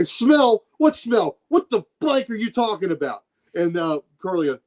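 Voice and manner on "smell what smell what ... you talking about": higher-pitched, incredulous mobster outburst; indignant disbelief. Acted. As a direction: act out an offended tough guy. Acted mobster's outburst at Wolverine.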